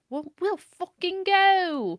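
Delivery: slow, hammed-up posh British; put-on British accent. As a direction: drawl it like a mock British toff. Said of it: "I don't know why I'm speaking in that sort of accent".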